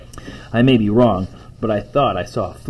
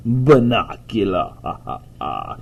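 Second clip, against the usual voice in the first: amused spooky savoring. Acted. Father savors the spooky name, chuckling.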